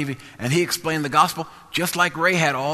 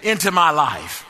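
loud, high preacher's climb; rising testimony preaching over music; preaching at full force; 5 semitones higher, 6 dB louder than usual. Acted. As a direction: build the sermon to a shout.

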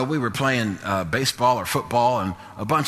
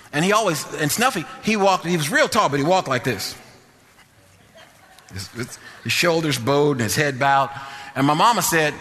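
ordinary talk, nothing marked

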